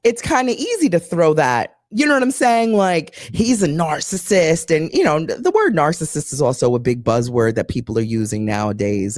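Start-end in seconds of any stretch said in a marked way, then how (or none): none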